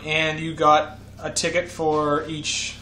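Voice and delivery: normal talking voice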